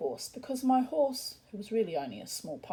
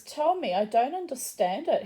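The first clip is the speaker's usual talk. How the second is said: higher, puzzled imitated Polish accent; 5 semitones higher, 5 dB louder than usual. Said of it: "in a strong Polish accent".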